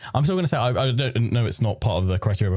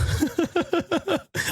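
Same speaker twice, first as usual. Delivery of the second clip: high laugh. Real, not acted. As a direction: giggle up high. Laughing at iPad case.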